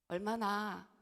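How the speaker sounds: normal talking voice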